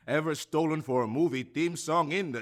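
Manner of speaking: fast-talking sideshow huckster selling records; a hammed-up barker's spiel, swelling to a big finish; carnival barker pitch. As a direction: hawk it like a fairground barker.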